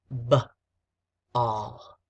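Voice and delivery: "B, all" split into sounds with a long pause; sounded out. Shown as a demonstration. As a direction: say "B", stop, then "all". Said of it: "the first word is".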